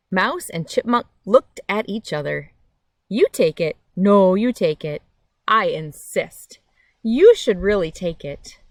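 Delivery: lively picture-book reading with big pauses; reading to children. A narrator's reading. Reading a picture book to kids on a nature channel, lively storytime voice. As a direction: read it like storytime for little ones.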